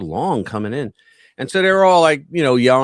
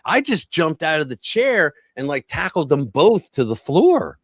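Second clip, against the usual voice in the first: usual speaking voice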